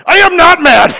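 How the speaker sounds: loud, high angry denial; indignant shout. Retold or imitated. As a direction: yell it, insisting you're not mad. Angry inner denial after niece.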